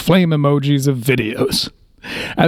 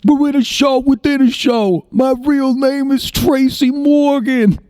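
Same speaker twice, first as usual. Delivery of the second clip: Tracy Jordan; 10 semitones higher than usual. Retold or imitated. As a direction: do a spaced-out TV star who's off his meds. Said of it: "he says".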